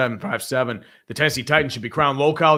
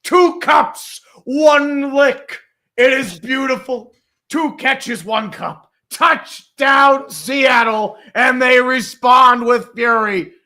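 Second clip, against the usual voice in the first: high, loud ecstatic shout; touchdown call; 9 semitones higher, 8 dB louder, much slower than usual. Real, not acted. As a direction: scream the touchdown like a hyped announcer. Touchdown call, hyped announcer.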